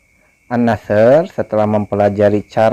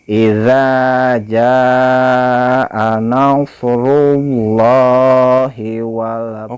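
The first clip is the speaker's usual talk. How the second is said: slow, drawn-out chanted recitation; Quran recitation; 3 semitones higher, much slower than usual. Shown as a demonstration. Teacher recites the verse for pupils, slow chant. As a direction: chant the verse slowly and melodically.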